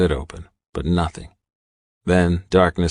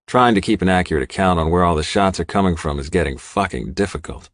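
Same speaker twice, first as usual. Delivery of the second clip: plain reading voice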